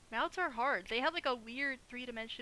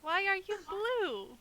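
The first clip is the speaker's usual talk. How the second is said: slow mocking echo; 8 semitones higher, slower than usual. Echoing partner's wail.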